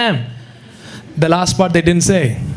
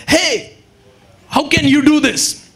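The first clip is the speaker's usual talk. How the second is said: a hint of an offended cleric; indignant objection; 5 semitones higher than usual. Temple leaders objecting to Jesus, mock outrage, higher.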